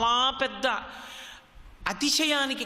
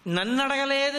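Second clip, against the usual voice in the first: నన్ను pitched higher, అడగలేదు ending on an indignant rise; wounded ego; 6 semitones higher than usual. Shown as a demonstration. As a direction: say నన్ను అడగలేదు like a slighted man whose pride is hurt. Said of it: "అహంకారం ఎక్కడో పొటమరించిందని". (అహంకారంతో ఎత్తైన స్వరంలో నన్ను అడగలేదు)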